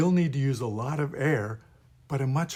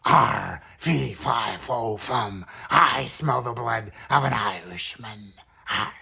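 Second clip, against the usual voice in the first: low, throaty giant's chant, louder; 3 semitones lower, 3 dB louder than usual. Acted. Giant from the beanstalk smelling blood, low growling voice. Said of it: "the Giant of the Beanstalk story".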